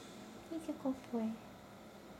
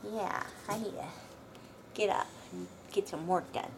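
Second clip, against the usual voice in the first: usual speaking voice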